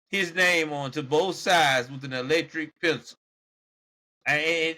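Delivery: ordinary delivery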